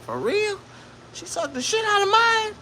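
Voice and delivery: very high falsetto fake surprise; mock surprise. Comic answering his homeboy's complaint, high falsetto fake surprise. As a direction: squeak it out in faux disbelief. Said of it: "And I'm like".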